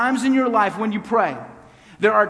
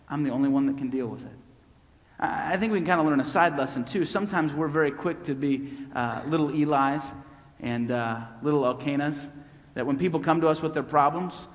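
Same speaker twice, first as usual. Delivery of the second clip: ordinary talk, nothing marked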